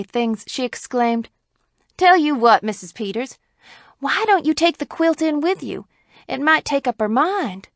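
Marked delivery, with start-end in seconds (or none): 2.0-7.7 higher pitch, struck by an idea; happy exclamation. A narrator's reading. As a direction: exclaim it with relieved cheer, pitched up. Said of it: "as if struck with a happy thought and relieved".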